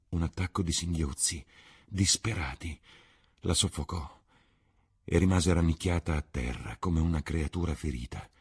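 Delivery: ordinary delivery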